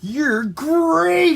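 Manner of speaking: high, loud exclamation; excited storybook character; delighted praise. Acted. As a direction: gush to your new friend. New friends praising each other.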